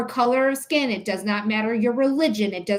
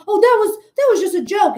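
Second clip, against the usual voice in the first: much higher, louder shocked mock voice; offended woman mock; 10 semitones higher, 6 dB louder, faster than usual. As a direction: squeal it like a caught, indignant woman. Mimicking racist woman's excuse.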